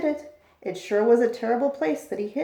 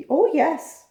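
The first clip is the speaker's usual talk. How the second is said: higher, louder little piping voice; piped; 5 semitones higher, 5 dB louder than usual. A narrator's reading. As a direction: pipe up brightly like a tiny creature. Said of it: "piped the voice".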